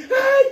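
single high, loud mock shriek; hammed-up horrified yelp; nun's scream. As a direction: cry out sharply, pitched far up. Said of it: "empieza a gritar".

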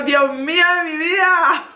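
high, drawn-out despair; exasperated. Retold or imitated. Mother despairs as the baby screams again. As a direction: sigh it out in disbelief. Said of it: "y yo".

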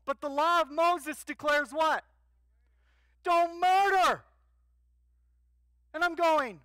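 high, loud, driving sermon climax; preaching at full force. Acted. Pastor pressing the congregation on Paul, loud preaching. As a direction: preach it hard, rising to a shout.

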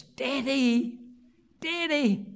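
voicing small son. Little boy calling to his father at the terminal, lightly voiced. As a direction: call out like a little boy spotting his dad. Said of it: "I heard a voice".

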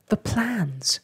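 high, sudden exclamation; startled storybook cry. A narrator's reading. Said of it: "cried Snugglepot".

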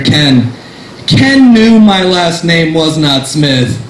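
ordinary talk

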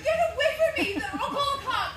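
high, loud, frightened cry; panicked shout. Acted. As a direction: shout him off in terror. Stranger grabs at Mary.

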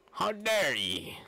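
slow indignant crone cry; old hag. Acted.

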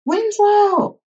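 very high, sustained search call. A narrator's reading. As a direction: call down the street, holding the name. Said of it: "All along the street were calls of".

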